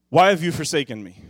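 the forsaken shout of the cross; loud anguished plea; anguished cry. Acted. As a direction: cry it out loud and high with a gasp. Acting Jesus crying out.

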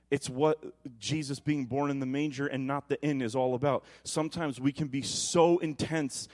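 ordinary talk

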